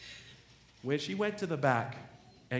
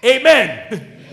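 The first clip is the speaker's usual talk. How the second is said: loud amen; 9 semitones higher, 18 dB louder than usual. Real, not acted. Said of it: "preacher calls out".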